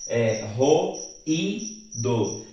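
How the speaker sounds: slow syllable-by-syllable demo of ro, i, do; split into syllables. Shown as a demonstration. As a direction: spell out ro, i, do syllable by syllable. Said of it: "Veja que a letra I está sozinha".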